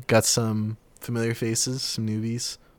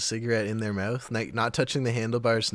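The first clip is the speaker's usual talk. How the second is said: ordinary talk, nothing marked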